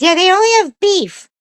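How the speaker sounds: very high, loud dog voice; talking dog. Acted. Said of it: "he goes".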